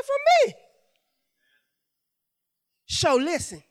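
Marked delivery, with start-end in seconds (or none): 0.0-0.6 very high, loud retort; exclaimed answer. Retold or imitated. Preacher voices the Lord's answer, exclaiming high.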